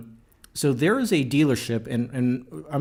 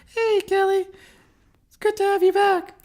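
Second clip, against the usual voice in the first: much higher, squeaky put-on voice; high-pitched voice; 6 dB louder than usual. Greeting the co-host in a mock high-pitched female voice. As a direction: greet her in a very high falsetto. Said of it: "Presumes they're female with high-pitched voices".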